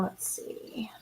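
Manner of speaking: slow whisper to herself; whispered aside. Real, not acted. Streamer scanning chat, half to herself, in a slow whisper. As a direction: breathe it out while scanning the chat.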